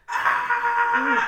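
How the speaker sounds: loud, very high shriek; imitated creature scream. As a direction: screech like the small moaning creature. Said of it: "and then it goes, like".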